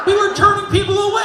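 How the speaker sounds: loud, fast, high bragging with a laugh; bragging nurse. Retold or imitated. Nurse brags to comedian about busy rooms, fast high and laughing. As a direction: brag it fast and gleeful, laughing.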